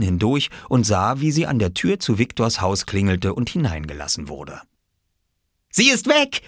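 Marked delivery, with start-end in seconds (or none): 5.7-6.4 put-on high, eager call of a boy; excited call. A narrator's reading. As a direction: announce it with glee, much higher. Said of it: "rief Oskar".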